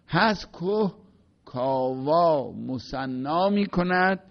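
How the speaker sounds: slow, sonorous verse reading; recited Persian verse; poem recitation. Shown as a demonstration. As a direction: let the line unfold slowly like a chant.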